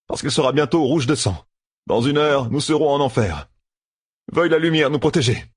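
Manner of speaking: voix plus haute, solennelle et fataliste; préparer Egwene au carnage; discours sombre. A narrator's reading. Matt grim speech to Egwene. (Matt annonce la bataille à Egwene, grave)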